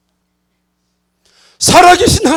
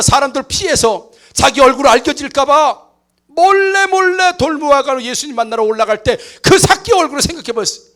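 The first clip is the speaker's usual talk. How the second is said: ordinary talk, nothing marked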